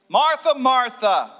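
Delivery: loud, slow call of Martha; Jesus calling. Pretend. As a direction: call her name firmly. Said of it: "the Lord answered".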